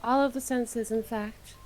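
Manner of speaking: dreamy hushed recital; soft ASMR voice. Pretend. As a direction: murmur it like an ASMR script. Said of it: "doing A S M R voice".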